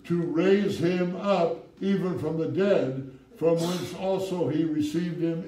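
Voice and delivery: fervent pulpit reading; preaching at full force. Acted. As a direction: drive the verse home, slowing on the key line. Preaching scripture with call-outs.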